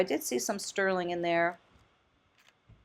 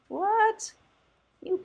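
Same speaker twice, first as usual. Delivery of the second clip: surprised; 13 semitones higher than usual. Real, not acted. Startled by a chicken pendant.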